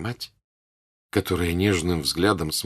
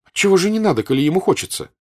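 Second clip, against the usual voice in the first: high, loud protest; indignant girl; 11 semitones higher, 7 dB louder, faster than usual. Acted. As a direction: daughter objecting to the countess. Daughter objects to her mother.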